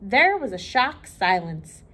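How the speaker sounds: lively read-aloud for children, voicing each character; storybook reading. A narrator's reading. Librarian reading Little Miss Sunshine to children, lively storytelling.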